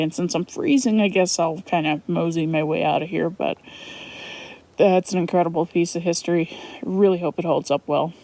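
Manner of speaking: ordinary talk, nothing marked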